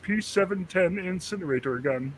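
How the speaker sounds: slow cartoon alien threat; Marvin the Martian voice. Shown as a demonstration. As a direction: menace like a tiny Martian. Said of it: "can you do the Marvin the Martian voice?".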